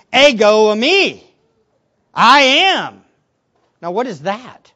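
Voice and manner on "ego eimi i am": loud, weighty declaration; emphatic. Acted. Jesus declaring who he is, emphatically. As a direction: land each phrase firmly and forcefully. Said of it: "He's emphatically saying".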